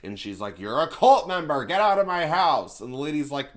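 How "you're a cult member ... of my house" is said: higher, louder mock outrage; mock accusation. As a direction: hurl it like a hammed-up accusation. Acted accusation in a recap.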